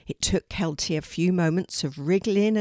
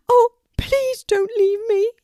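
very high, loud, desperate bird; pleading; 8 dB louder than usual. A narrator's reading. Trapped Poppy begs Kelty not to go, pleading. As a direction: beg her to stay, squeaking high. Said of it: "pleaded Poppy".

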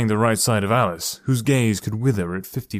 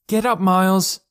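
much higher, louder call of a sister; shouting through a door; 9 semitones higher, 5 dB louder than usual. Acted. Sister shouts at her brother through the bedroom door. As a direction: yell to wake him through the door.

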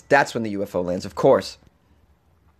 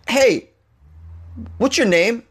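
calling out; 11 semitones higher, slower than usual. Retold or imitated. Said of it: "some dude goes".

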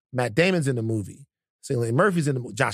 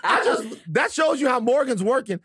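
high-pitched, laughing astonishment; amazed disbelief; 12 semitones higher than usual. Real, not acted. Host learns their made-up cast guess was right, amazed disbelief. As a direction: squeal it through laughter, stunned your guess landed.

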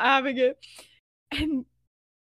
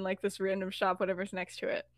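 plain reading voice

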